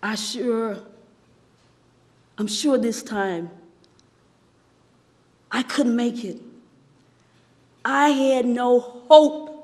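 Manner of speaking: low, soft, halting delivery; hushed despair. Acted. Teacup in the oven loses hope, hushed despair. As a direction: sink into hopelessness, with long pauses.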